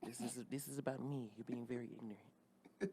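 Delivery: hushed, wounded [name]. Pretend.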